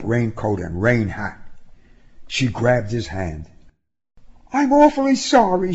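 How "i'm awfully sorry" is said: high-pitched, earnest apology; high, pleading sorry; apologetic young woman. Acted. Woman apologizes, voiced by narrator.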